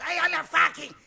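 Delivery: high voice of a livid man; mock furious yelling. Angry guy keeps going while being interrupted, yelling. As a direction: rage on over the interruption. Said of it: "within him yelling".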